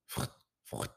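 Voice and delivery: soft breathy brushing sound; brushing noise. A narrator's reading. Animal brushing its fur, a soft voiceless frout. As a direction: brush strokes made with the breath only. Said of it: "fait la brosse".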